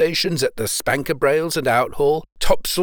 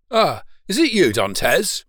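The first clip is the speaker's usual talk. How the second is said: higher, lightly raised call; 4 semitones higher than usual. A narrator's reading. Man in a skiff hails a young sailor, calling out. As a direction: hail someone you recognise, voice lifted. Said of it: "cried the man in the skiff".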